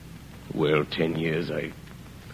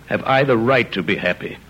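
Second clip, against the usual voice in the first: ordinary delivery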